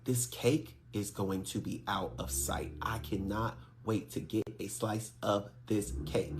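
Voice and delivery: slow, hushed whisper, savoring each word. Retold or imitated. Wedding guest raving to herself about the cake, whispered. Whispered thought while tasting food.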